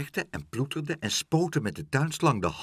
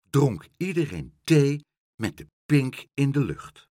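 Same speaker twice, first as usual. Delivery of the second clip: plain reading voice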